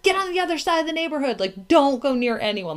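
빠르고 높게 다그치는 말투; intense warning. Retold or imitated. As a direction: rush it out, high and bossy. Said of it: "I've been very intense about it".

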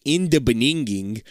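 "beninging" said slowly for beginning; garbled beginning. Retold or imitated. Quoting a TikTok, "beninging" said garbled. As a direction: mangle beginning into "beninging", drawn out. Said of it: "Have you ever seen that TikTok".

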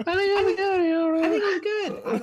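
drawn-out, loud falsetto squeal; exaggerated sky-high squeak, played for laughs; high pitch change. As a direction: stretch it out in an absurdly high, loud voice. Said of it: "That tone change".

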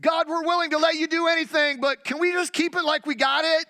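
high, loud whiny prayer; mocking church folk. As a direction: parody a stubborn congregation. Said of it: "in the church we say".

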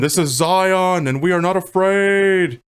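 high, slow mock-grand orator; hammed-up defiant proclamation; Morpheus. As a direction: stretch it out like a rousing speech. Imitating Morpheus's rallying speech.

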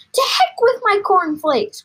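high, loud dismissive yell; exploding storybook father; impatient cry. A narrator's reading. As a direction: shout it away in excitement. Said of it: "cried Mister Cranky".

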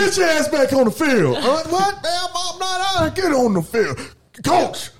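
dad yelling. Retold or imitated. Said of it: "that's how my pops was".

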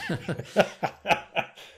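real burst of high laughter. Real, not acted. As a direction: laugh out loud at the joke. Laughing at the accent.

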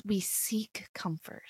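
soft, slow whisper. Real, not acted. Coach confiding to listeners, in a slow whisper. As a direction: breathe the words like a secret. Whispered aside about comfort.

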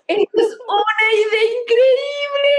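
high, slow, laughing producer; excited producer voice. Retold or imitated. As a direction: gush like a thrilled movie producer. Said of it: "El productor dijo". (productor entusiasmado entre risas)

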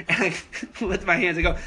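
ordinary delivery

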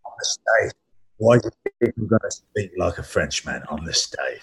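lightly imitated husky film-star voice; Jason Statham. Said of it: "his normal Statham voice".

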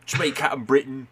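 Captain Britain arriving at the pub, mock Cockney; mock Cockney accent. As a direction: greet the lads like a cheery Cockney. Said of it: "Cockney British accents".

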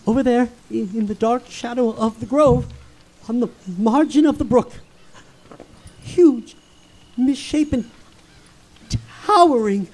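halting, hushed fear with long pauses; awestruck dread. Acted. Ichabod spotting a shape in the grove, hushed dread. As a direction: let each word out fearfully, one by one. Sees a looming shape in darkness.

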